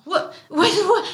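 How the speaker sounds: high, confused "What?" repeated with a laugh; baffled repeated what. Retold or imitated. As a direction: play the foreigner who has never heard of ranch. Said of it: "he said ranch back with a question mark".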